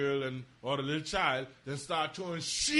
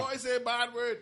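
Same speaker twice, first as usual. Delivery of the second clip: put-on young son; 6 semitones higher than usual. Young son tells Daddy about swearing in music, imitated child voice. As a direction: lightly imitate a little boy reporting to his father. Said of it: "he can say".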